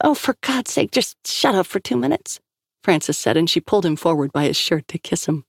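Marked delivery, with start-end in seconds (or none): 0.1-2.4 high, fed-up cut-off; exasperated outburst. A narrator's reading. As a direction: snap him out of his babbling, fondly. Frances cuts off rambling Liam.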